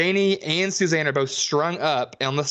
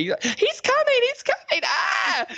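very high squealed mock panic; slower than usual. Mocking the girls fleeing from Orville Ketchum, hammed-up shriek. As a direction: squeal it like a scared horror girl. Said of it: "and they".

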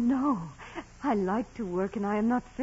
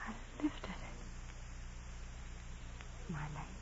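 hushed; 5 semitones lower, 17 dB quieter than usual. Acted. Revealing diseased leg.

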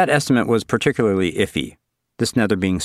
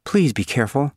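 imploring; 5 semitones higher than usual. Acted. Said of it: "Suzanne implored".

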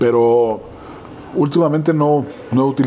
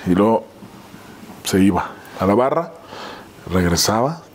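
ordinary talk, nothing marked

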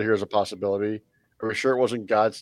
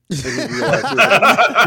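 laughing; 7 semitones higher, 8 dB louder than usual. Real, not acted. Joking about [name]'s edibles.

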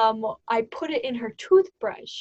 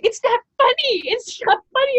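very high, loud protest; upset scolding; 13 semitones higher, 6 dB louder than usual. Retold or imitated. Roommate scolding the laughing speaker, very high and loud. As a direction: insist it isn't funny.

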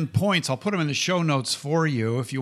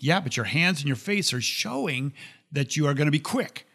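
ordinary delivery

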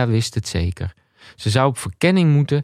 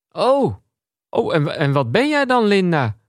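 flustered surprise; 7 semitones higher than usual. Acted. Flustered man talks to tapeworm.

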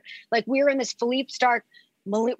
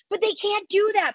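put-on much higher, childish indignation; mock indignant protest; 11 semitones higher than usual. [name] imitating herself arguing with the crew, mock indignant. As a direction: protest like a kid who was wronged. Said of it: "I'm like".

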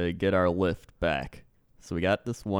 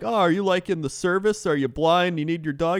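high, sceptical mock Canadian; put-on Canadian accent, pitched up; 7 semitones higher, 4 dB louder than usual. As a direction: quiz them like a doubtful Canadian driver. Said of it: "need you to use that accent".

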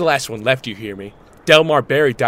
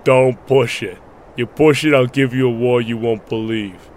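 a bad Stallone accent, lightly done; Stallone impression. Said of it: "Is that supposed to be a Stallone accent".

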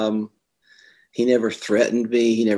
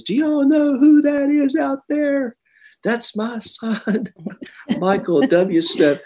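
much higher, slow, stretched-out proud shout; proud dad calling out; 3 dB louder, much slower than usual. Retold or imitated. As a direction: call it out high and slow, bursting with pride. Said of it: "leans his head off the stretcher".

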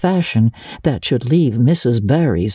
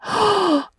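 sharp gasp; 3 dB quieter than usual. A narrator's reading. Marilla smells liniment.

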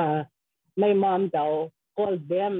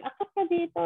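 mild mock call upstairs; 11 semitones higher, faster than usual. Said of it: "she was going".

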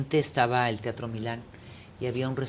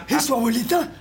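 mimicked question; 9 semitones higher, 3 dB louder than usual. Acts the co-actor's startled question.

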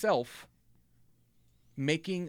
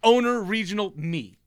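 usual speaking voice

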